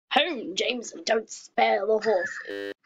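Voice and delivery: put-on a light posh English accent, slow on the second half; a lightly hammed-up posh English accent, like giving orders to a driver. Vlogger finally does her embarrassing posh English line for the camera, a light posh English accent. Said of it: "posh English line".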